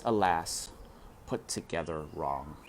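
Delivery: usual speaking voice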